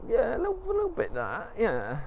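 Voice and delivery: very high, coy rising admission; wife's voice. Retold or imitated. Wife admits she wanted his fame, high coy voice. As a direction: squeak it out sheepishly.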